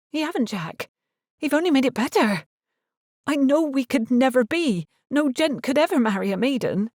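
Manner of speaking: put-on anxious young woman, pleading; Gwynna's voice. A narrator's reading. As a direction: voice her as an earnest young maid offering to leave.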